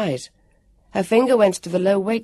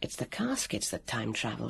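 hushed, near-whispered thought; very soft, low inner voice; sudden realisation; 4 semitones lower, 12 dB quieter than usual. A narrator's reading. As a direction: think it aloud, softly, as it dawns. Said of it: "she thought in a surge of understanding".

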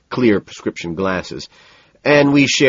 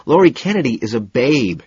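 BABE declared loud and higher; louder, higher, emphatic assertion; 5 semitones higher, 5 dB louder than usual. A narrator's reading. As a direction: insist on it, punching the words. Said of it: "Hamlin says emphatically".

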